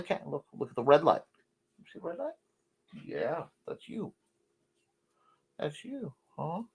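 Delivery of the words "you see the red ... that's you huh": high, soft cooing to the cat. Real, not acted. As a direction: coo softly at the cat. Showing the cat the camera.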